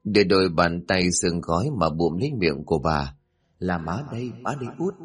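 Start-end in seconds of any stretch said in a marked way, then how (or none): 3.6-4.9 hushed ghostly mother. A narrator's reading. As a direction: reveal yourself in a hush. Ghost mother quietly identifying herself. (giọng má thì thào khẽ khàng)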